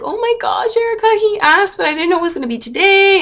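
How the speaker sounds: high, breathless mom impression; imitating excited mom. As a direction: squeal like a thrilled mother who just heard the news. Voicing mom on phone call.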